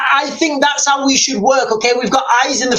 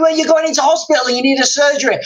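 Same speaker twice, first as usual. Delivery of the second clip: usual speaking voice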